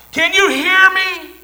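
far-off yell, very high and loud; hollering. Retold or imitated. Rescuer hollering down the mine to trapped miners. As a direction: call down into the mine. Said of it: "Someone hollered out".

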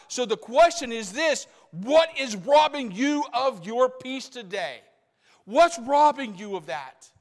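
high and loud, hammering questions; full-force preaching. Acted. Preacher challenging the congregation, loud questioning preaching.

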